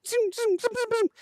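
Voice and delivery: very high zinging noises; rapid-fire bullet sound effects; imitated whizzing bullets.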